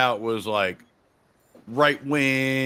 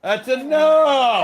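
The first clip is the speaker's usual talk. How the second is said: slow, higher, loud stern shout; shouting at dog; 12 semitones higher, 6 dB louder, much slower than usual. Real, not acted. Host shouting at his noisy dog mid-show, slow loud command. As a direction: shout it slowly to make the dog quit.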